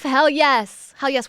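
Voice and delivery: loud happy cheer. Real, not acted. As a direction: celebrate it. Cheering for chat.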